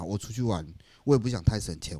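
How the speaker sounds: normal talking voice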